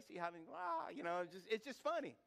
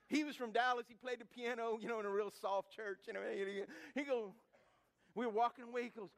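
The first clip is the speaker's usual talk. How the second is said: normal talking voice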